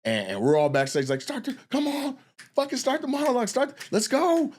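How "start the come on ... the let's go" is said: urging. Retold or imitated. Urging her on from backstage.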